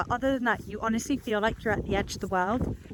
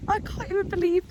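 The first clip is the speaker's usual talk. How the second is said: delighted squeal; 10 semitones higher than usual. Real, not acted. Spotting baby kangaroos, she gushes in high-pitched delight. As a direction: squeal with pure joy. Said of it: "made me so happy".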